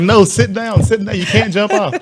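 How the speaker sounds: high, loud urgent commands with a laugh; panicked shouting. Retold or imitated. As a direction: yell at a scared kid to sit down. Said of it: "And I was like".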